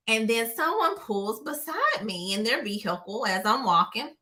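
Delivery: ordinary talk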